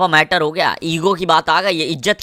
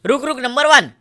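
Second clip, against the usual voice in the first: high, loud repeated shout; urgent, higher shouted alert to the squad; excited callout; 8 semitones higher, 3 dB louder than usual. Real, not acted. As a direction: yell the sighting at your teammate. Spotting the enemy again.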